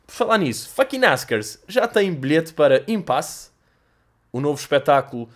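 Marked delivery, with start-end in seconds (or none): none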